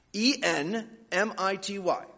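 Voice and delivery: E N M I T Y letter by letter; spelling out. Shown as a demonstration. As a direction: spell E N M I T Y clearly for kids. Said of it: "I want all the kids to say that word".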